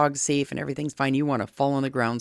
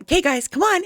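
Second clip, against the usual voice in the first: much higher, louder cheerful call; happy upbeat call; 6 dB louder than usual. Retold or imitated. Owner calling the fighting dogs to the ball, bright happy call. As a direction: call the dogs like it's playtime. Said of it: "I went".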